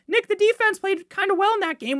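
high mocking voice; imagined critic. Critic telling the host the defense was fine, high mock voice. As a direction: mimic a know-it-all fan, high. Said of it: "Now, I know".

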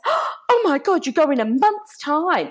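gasped, high-pitched mock dismay; dramatic mum. Mum reads daughter's army joining date, dramatically aghast. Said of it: "my mum was very dramatic".

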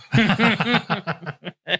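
loud, high laughing fit. Real, not acted. Friend cracking up loudly at the doctor joke.

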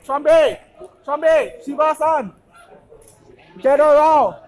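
loud call across the room. Real, not acted. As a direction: shout to summon friends. Calling friends over loudly.

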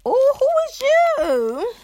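put-on high, slow, swooning girl voice; fawning squeal. Girl gushing over a pretty stranger, high squeal. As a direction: gush at her in a high squeal.

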